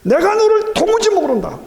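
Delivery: thundering rebuke. Acted. Preacher voicing the Lord's rejection of the boaster, thundering. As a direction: shout it out like judgment falling.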